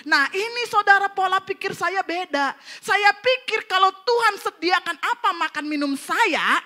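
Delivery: high-pitched, insistent sermon delivery; emphatic preaching. Real, not acted. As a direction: press the point on the congregation.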